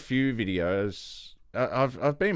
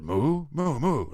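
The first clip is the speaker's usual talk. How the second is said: higher moos shaped like speech; explaining cow; 5 semitones higher, slower than usual. Acted. Second cow passes the word on, higher talking moos. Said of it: "he goes off, and he goes".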